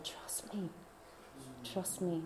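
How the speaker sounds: barely voiced, soft and low; reassuring whisper. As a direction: whisper it gently to calm her. Jesus' reassurance in the dream.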